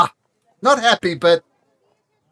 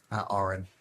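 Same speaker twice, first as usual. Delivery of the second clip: ordinary delivery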